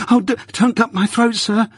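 put-on a frightened little boy begging for his life; pleading in terror. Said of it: "I pleaded in terror".

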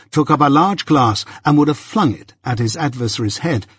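plain reading voice

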